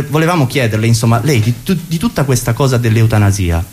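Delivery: ordinary delivery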